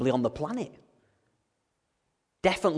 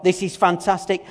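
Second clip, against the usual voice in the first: louder mock enthusiasm; sarcastic Moses; 3 semitones higher, 7 dB louder than usual. Moses sarcastically cheering God, loud. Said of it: "I bet Moses at this point is thinking".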